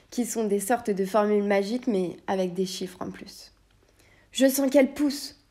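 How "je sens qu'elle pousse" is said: plain reading voice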